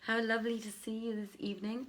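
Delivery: usual speaking voice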